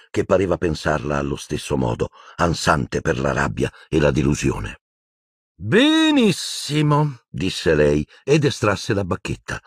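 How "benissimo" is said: high, drawn-out, syrupy menace; agitated villainess. Acted. Umbridge drawing her wand on Harry, high agitated drawl.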